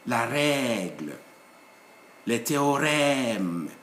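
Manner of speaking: "règle" and "théorème" slow, open e held long; gliding A sound. Shown as a demonstration. Teacher modelling accent grave, vowel gliding. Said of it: "like a gliding A sound".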